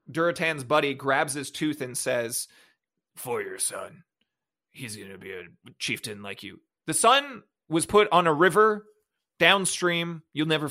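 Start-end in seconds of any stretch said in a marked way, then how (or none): 3.2-6.6 a touch lower, solemn orc pledge; mock-solemn orc vow, lower; lightly voiced orc warrior. Recapping the movie, orc buddy's vow to dead chief, lower mock-solemn.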